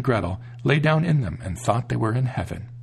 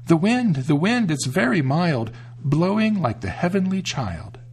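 put-on child voices; 7 semitones higher than usual. A narrator's reading. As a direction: answer in innocent children's voices. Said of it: "The children answered".

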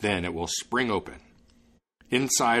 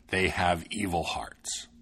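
slow whispered dwarf warning; ominous whisper; 3 semitones lower, slower than usual. Acted. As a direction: whisper it slowly, like a dark secret. But not without a warning.

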